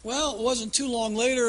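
ordinary talk

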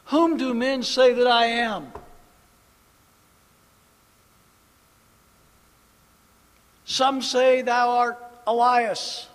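full-voiced scripture recital; preached scripture. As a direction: proclaim the verses. Preached Bible dialogue.

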